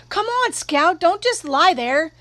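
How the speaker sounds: high, urgent yell; screaming. A narrator's reading. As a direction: yell at someone to move. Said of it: "Jim was screaming".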